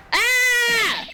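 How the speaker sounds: high, loud shriek of fright; startled scream. Real, not acted. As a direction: scream in sudden shock. Startled by yokai appearance.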